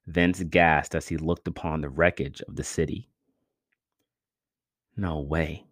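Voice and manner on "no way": soft, choked disbelief; the words catch in the throat. A narrator's reading. Vince staring at the wrecked city, choked and quiet. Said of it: "he choked".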